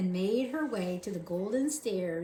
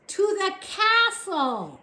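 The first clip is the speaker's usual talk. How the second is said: slow, high, loud shout; 11 semitones higher, 8 dB louder, slower than usual. A narrator's reading. Soldier shouting slowly so deaf Mig hears. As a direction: bellow each word. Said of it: "shouted the soldier".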